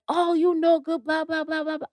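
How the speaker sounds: high-pitched mock cussing, half-hearted; hammed-up imitation of an angry tirade.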